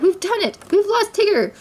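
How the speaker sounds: high, excited victory announcement; triumphant, high-pitched glee; jubilant cry. A narrator's reading. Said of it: "he cried".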